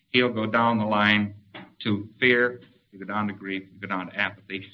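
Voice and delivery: understated slide into listlessness; sinking toward apathy. Shown as a demonstration. Acting descent down the tone scale.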